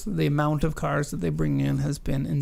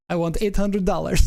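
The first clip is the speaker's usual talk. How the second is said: imitated Ukrainian accent; 3 semitones higher, 3 dB louder than usual. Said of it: "I wish I could do his accent".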